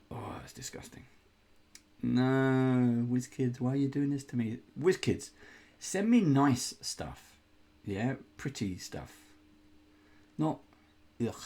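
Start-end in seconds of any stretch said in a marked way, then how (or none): 0.1-1.0 breathy whispered disgust, much softer. Real, not acted. Disgusted by a spider mini. 2.0-7.4 hammed-up whiny appeal, slightly higher; mock plea.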